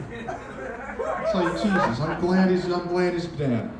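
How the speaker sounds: usual speaking voice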